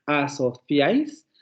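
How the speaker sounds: asociáis with a Castilian θ, slow; Spain Spanish. Shown as a demonstration. Teacher repeating the Spain pronunciation slowly. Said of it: "así lo dicen en España".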